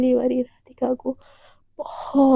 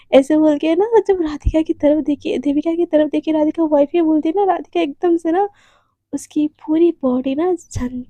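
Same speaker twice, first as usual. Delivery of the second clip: ordinary delivery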